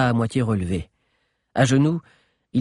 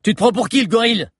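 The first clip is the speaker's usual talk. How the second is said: higher, louder mocking jab; insolent taunt; 11 semitones higher, 6 dB louder, faster than usual. A narrator's reading. Boy guard taunting the giant, insolent. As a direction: sneer at him.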